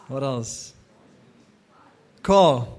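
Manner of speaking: ordinary talk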